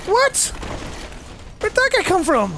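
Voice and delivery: high, loud startled outburst. Real, not acted. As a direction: caught off guard, pitch leaping up. Startled by an enemy in game.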